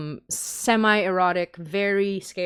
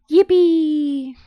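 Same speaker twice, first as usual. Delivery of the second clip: high, loud yippee; mock cheer; 9 semitones higher, 7 dB louder than usual. Host cheering to close the stream. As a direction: cheer like a cartoon.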